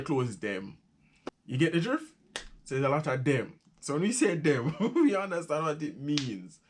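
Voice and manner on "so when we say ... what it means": raised, laughing delivery. Real, not acted. Explaining Jamaican slang to viewers, laughing. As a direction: chuckle through the point.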